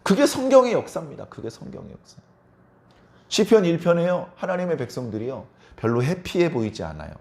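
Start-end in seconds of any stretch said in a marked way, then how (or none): 1.2-2.2 그게 성경의 역사 breathed in a whisper; whispered echo. Shown as a demonstration. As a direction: let it fall to a whisper. Whispered echo of line 111. (속삭이듯 되뇌기)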